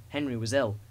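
ordinary delivery

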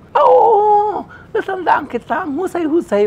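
high, loud, gushing recognition; feigned delight. Retold or imitated. Old director recognizing the actor, high and gushing. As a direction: gush over him, a bit too much.

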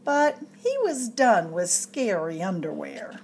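ordinary delivery, nothing marked

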